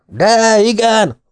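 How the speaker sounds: high, loud, slow defiant yell; Scottish fans yelling back at the detective; crowd yelling back. A narrator's reading. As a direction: shout him down. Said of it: "ordítoztak azok".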